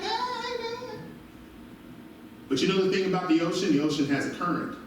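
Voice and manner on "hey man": much higher easygoing hey man; lazy friendly greeting back; laid-back reply. Retold or imitated. Beach crowd answering.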